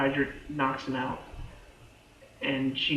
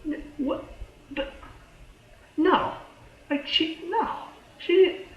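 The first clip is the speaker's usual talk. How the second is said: shocked disbelief. Retold or imitated. Fan reliving a character's leap, high shocked denial. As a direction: voice breaks upward in disbelief.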